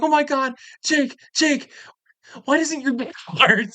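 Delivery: high, frantic imitated girlfriend; panicked squeal. Girlfriend rushing to Jake, mock panicked high squeal. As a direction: mimic her frantic high alarm.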